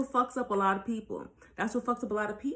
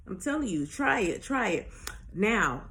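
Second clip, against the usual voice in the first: ordinary talk, nothing marked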